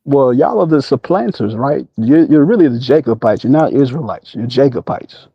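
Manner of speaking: ordinary talk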